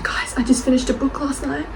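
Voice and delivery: whispered to school friends; retold whisper. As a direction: hush it like a secret at school. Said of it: "I was like".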